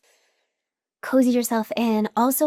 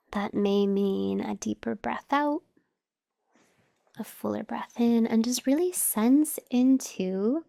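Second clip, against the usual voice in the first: slow, measured meditation voice with long pauses; guided meditation; slower than usual. Acted. Teacher guiding listeners to notice their breath, calm meditation voice. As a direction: guide them unhurriedly through each breath, pausing between phrases.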